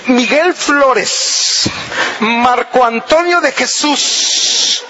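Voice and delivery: slow, drawn-out stern naming; serious full-name warning. Shown as a demonstration. Wife calling husband by full name, stern. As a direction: stretch out each full name without shouting. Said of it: "más seria todavía. Todavía sin gritar".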